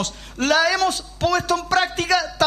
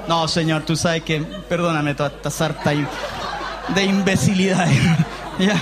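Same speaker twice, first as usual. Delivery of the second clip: sheepish apologising sea; 11 semitones lower than usual. Acted.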